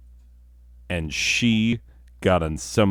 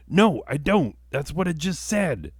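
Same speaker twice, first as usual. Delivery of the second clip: higher, mock-exasperated no; exasperated retort; 10 semitones higher than usual. Comedian answering a Vietnamese speaker, mock exasperated and higher. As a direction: snap back higher in mock frustration.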